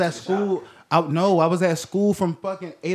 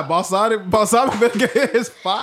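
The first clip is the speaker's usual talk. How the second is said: loud, high, laughing through it; laughing insistence that balsamic vinegar is fire; laughing defense; 6 semitones higher, 6 dB louder than usual. Real, not acted. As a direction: defend it while cracking up.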